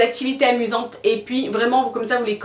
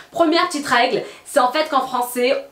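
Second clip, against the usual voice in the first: ordinary talk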